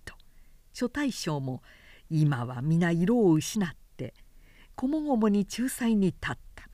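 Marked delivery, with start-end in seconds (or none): none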